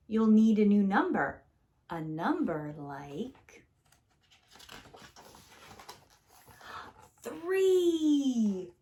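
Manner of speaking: put-on gentle read-aloud to children; storybook reading. A narrator's reading. Teacher reading a picture book to kids, gentle and lively. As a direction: read it warmly, like story time.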